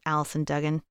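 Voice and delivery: ordinary delivery, nothing marked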